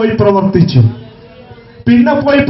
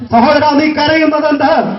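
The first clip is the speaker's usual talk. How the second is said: sustained raised-pitch exhortation; impassioned preaching; 4 semitones higher than usual. Real, not acted. Preacher turns Jesus' question on weeping listeners, impassioned preaching. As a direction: press each question on the congregation without let-up.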